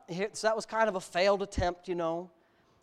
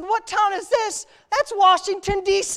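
much higher, louder, slowed mock disbelief; incredulous friend; 12 semitones higher, 9 dB louder than usual. Friend scoffing at the obvious answer, high and loud. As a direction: ham up a friend's "are you serious". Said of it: "He said".

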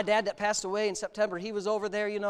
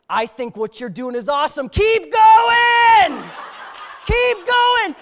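much higher, louder, laughing shout; cheering hippie; 10 semitones higher, 13 dB louder, much slower than usual. Retold or imitated. Brother egging runaways on over the phone, laughing shout. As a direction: hype them on like a delighted hippie. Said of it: "He goes".

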